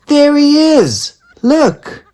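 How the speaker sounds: high, loud, drawn-out cry; yelled. A narrator's reading. Daisy yelling at her parents, high and loud. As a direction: shout to make them look. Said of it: "Daisy yelled".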